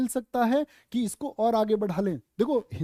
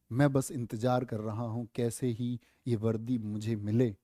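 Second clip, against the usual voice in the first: usual speaking voice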